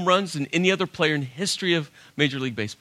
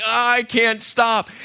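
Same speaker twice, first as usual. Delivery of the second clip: slow helpless plea; 5 semitones higher, much slower than usual. Retold or imitated. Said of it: "I'm like".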